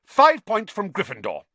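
high, loud curt penalty; terse. Acted. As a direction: announce it tersely, pulling rank. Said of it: "Percy said tersely".

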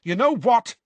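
loud, very high angry challenge; heated. A narrator's reading. Seamus turns on Harry before the others, heated. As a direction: burst out heatedly. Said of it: "said Seamus heatedly".

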